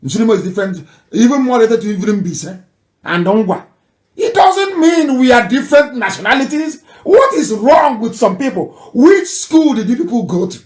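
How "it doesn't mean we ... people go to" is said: higher and louder, indignant rhetorical questions; exasperated scolding. Real, not acted. Commentator berating critics, exasperated and loud. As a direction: scold the doubters.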